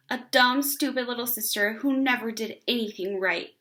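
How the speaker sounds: usual speaking voice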